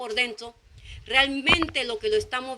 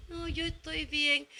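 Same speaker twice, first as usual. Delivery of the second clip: downcast reply; 2 semitones higher, 8 dB quieter, slower than usual. Retold or imitated. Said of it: "en ese tono de voz se escucha el desánimo".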